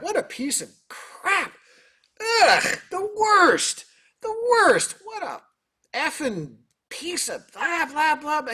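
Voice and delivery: high, hammed-up disgusted rant; dad going off. Musician mimics his dad panning the concert piece, disgusted rant. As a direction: imitate a dad ranting, stretching out the key words. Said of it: "he's going off".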